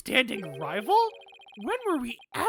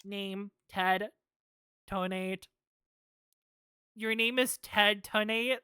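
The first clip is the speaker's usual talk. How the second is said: normal talking voice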